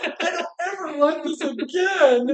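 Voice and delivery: a hint of a tired, whiny child; whiny kid voice. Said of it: "they're like".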